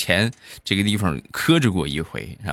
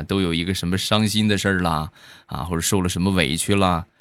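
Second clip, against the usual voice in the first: ordinary talk, nothing marked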